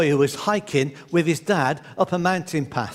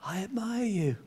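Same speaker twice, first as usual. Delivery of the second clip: soft, distant answering voice; echo; 3 semitones higher, 9 dB quieter than usual. Retold or imitated. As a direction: let it come back faint. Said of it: "And the voice came back".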